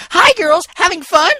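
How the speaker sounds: very high, loud and bouncy; a party pony bursting with energy; bubbly greeting. A narrator's reading. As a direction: squeal it out full of energy. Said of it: "still full of energy".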